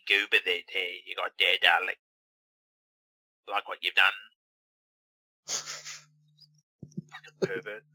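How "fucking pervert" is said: slow, breathy whispered jab; whispered aside. Acted. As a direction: hiss it under your breath. Muttered insult aside.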